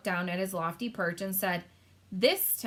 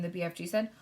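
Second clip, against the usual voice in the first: plain reading voice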